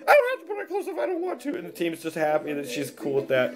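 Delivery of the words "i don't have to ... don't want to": high falsetto retort; protesting lady. Acted. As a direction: protest in a put-on woman's voice. Acted naked lady protests.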